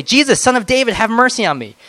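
high, loud plea for mercy; a beggar calling to Jesus over the crowd, high and loud; crying out. A narrator's reading. Blind beggar crying out to Jesus, pleading loudly. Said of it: "he began to cry out and say".

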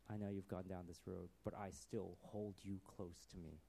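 hushed, low tenderness; very quiet, sincere comfort; loving. Acted. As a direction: reassure softly, as to a beloved friend. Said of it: "That this love".

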